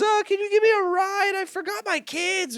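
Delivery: very high, mock-weeping plea; hammed-up wailing beggar woman; hysterical crying. Drug lady begging a driver for a ride, mock weeping. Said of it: "crying hysterically ... weeping".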